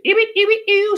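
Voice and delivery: very high, sharp mock disgust; disgusted squeal. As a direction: squeal ew like you smelled something.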